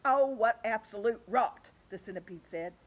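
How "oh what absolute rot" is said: a little shaky. A narrator's reading. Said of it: "his voice seemed suddenly to have become a little shaky".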